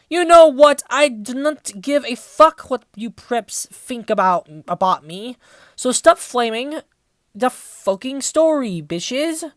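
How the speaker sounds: put-on snotty shouting teen author, loud and indignant; hammed-up rant at the haters; mock angry author rant. Reader voicing the author telling off critics, mock furious.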